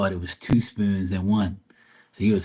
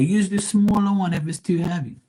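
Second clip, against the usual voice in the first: explaining to dad; 11 semitones higher than usual. Retold or imitated. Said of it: "I said".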